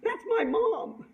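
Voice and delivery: high, drawn-out proud announcement; imitating a child's proud declaration; proud daughter. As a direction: show off proudly, slow and high. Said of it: "proudly says".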